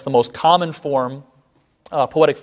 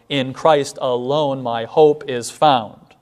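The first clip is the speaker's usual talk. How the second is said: slow, weak-strong beat through our help in ages past; measured hymn line; iambic recitation; slower than usual. Shown as a demonstration. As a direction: recite with stately iambic stress. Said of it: "written in iambic patterns".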